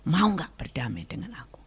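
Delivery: soft unvoiced whisper of God's question; a gentle inner whisper. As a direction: breathe it as a whisper. God's voice in her heart.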